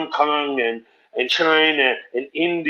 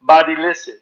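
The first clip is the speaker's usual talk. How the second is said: louder, insistent club owner voice; Jamie Masada pushing; 6 dB louder than usual. Retold or imitated. Owner insisting the young comic follows Dane, louder pushy voice. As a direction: lean on him like a pushy boss. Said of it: "And he would say".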